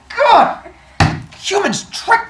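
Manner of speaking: loud, high exasperated cry; hammed-up high-pitched rage; upset outburst. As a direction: burst out furious, voice shooting up. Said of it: "And he gets upset".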